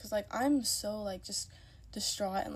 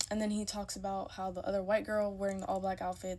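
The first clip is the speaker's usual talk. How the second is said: ordinary talk, nothing marked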